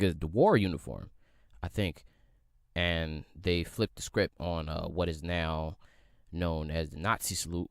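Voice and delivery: ordinary delivery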